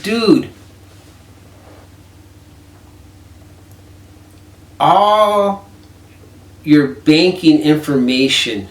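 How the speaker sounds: high, loud, drawn-out disbelief; exasperated yell. Retold or imitated. Yelling at a friend who left his debit card active, slow and loud. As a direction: blast him for leaving his card active.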